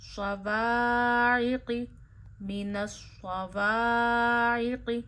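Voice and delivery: "स्ववाइक़ि" slow with heavy स्वाद and throaty ain; drawn alif, clipped final ि, heavy saad; alif drawn, zer short. Shown as a demonstration. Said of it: "दोनों के नीचे ज़ेर मत खींचिए". ("स्ववाइक़ि" मोटा स्वाद, छोटी ज़ेर)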